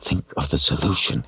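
quietly steady yourself; hushed self-instruction; talking to himself. A narrator's reading. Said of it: "he told himself".

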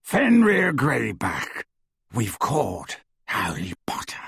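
werewolf. A narrator's reading. Greyback presenting his prize.

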